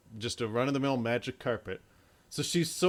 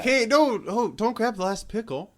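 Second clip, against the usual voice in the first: high, loud cry of petty alarm; mock protest; 10 semitones higher, 7 dB louder than usual. Comparing her alarm to losing a pickle, loud mock protest. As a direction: call out urgently over something trivial. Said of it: "Like, that level of just".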